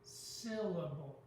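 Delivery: slow, drawn-out SYL in "Syllable"; stress on first syllable. Shown as a demonstration. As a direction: stretch the first syllable of "Syllable", let the others fall away. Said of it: "the first syllable is stressed".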